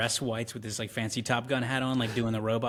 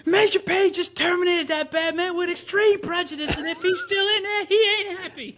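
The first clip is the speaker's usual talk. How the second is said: put-on much higher, louder child voice; excited little kid; 7 dB louder than usual. Kid bragging about the major to his mom, high and excited. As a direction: chirp it proudly like a six-year-old. Six-year-old boasting in retelling.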